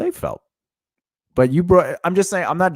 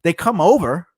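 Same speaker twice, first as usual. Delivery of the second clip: naive mock voice; 4 semitones higher than usual. Imitating naive partner.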